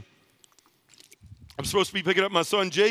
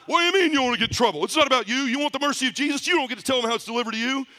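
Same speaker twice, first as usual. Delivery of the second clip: angry priest; 6 semitones higher, faster than usual. Retold or imitated. Priest scolding the penitent about mercy, angry and fast. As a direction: tear into him like a furious priest. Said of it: "he lost it".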